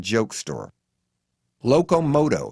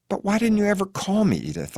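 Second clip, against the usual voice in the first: high-pitched plaintive question, dropping on the name; crying out; 9 semitones higher than usual. A narrator's reading. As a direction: cry out in reproach. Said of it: "I cry".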